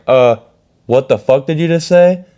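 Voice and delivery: high, loud angry challenge; confronting. Retold or imitated. Standing up to the teacher in class, furious. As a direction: demand she repeat it.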